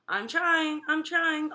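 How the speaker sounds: a hint of parrot voice; parrot echo. A narrator's reading. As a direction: echo it like a mimicking bird. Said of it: "Uncle Feather repeated".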